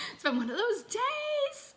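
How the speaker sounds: high, slow, put-on sigh; mock weary sigh. Real, not acted. Coach excusing her scattered live video, high slow mock-weary. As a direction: drawl it up high like a tired joke. Sheepish aside after laughing.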